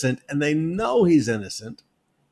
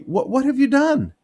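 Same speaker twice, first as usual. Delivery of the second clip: higher, demanding Pilate; a hint of Pilate; 11 semitones higher, 5 dB louder than usual. Pilate pressing Jesus in the sermon, demanding. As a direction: press him for an answer.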